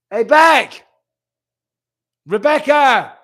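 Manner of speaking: loud, high call to someone out of earshot. Real, not acted. Asking Rebecca to bring glasses, calling out loudly. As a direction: holler the name to get her attention. Calls someone in another room.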